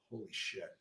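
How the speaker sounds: very soft retold disbelief; stunned hush. Patient hearing his surgery is on tomorrow, quietly stunned. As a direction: let it out under your breath.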